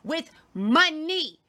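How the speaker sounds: hammed-up British accent, high and firm; mock British accent. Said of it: "in his little British accent".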